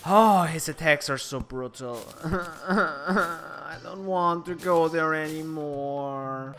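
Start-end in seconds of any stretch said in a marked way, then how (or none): 2.2-6.6 soft, slow, shaken whine; mock whimper. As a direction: whimper it out slowly and softly. Shaken after a sudden scare.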